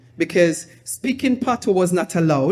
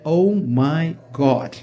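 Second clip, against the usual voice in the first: stunned; 4 semitones lower, slower than usual. Retold or imitated. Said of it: "looking at me like".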